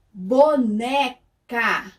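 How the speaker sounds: "boneca" very slowly, a gap between BO, NE and CA; syllable-by-syllable sounding out. Shown as a demonstration. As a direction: break "boneca" into three drawn-out syllables. Teacher sounds out word's syllables. ("boneca" bem devagar, sílaba por sílaba)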